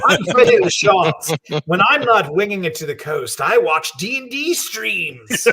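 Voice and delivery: high, drawn-out playboy swagger; international playboy. Acted. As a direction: ham it up as a famous jet-set playboy. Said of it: "sounds like some sort of international famous playboy".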